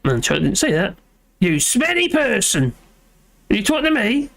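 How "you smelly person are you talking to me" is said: put-on Yorkshire accent. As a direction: try out a Yorkshire accent, playful. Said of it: "Let's try that".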